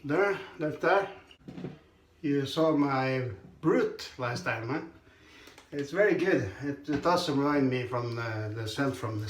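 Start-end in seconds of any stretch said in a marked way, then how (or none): none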